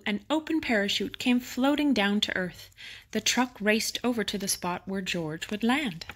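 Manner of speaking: usual speaking voice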